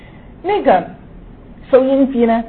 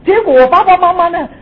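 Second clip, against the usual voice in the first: usual speaking voice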